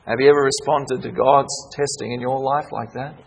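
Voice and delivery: ordinary delivery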